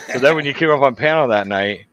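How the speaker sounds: usual speaking voice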